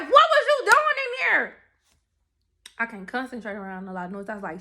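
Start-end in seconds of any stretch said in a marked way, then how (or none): 0.0-1.5 much higher, louder, slow disbelief; shocked demand. Retold or imitated. Demanding answers from friend in car.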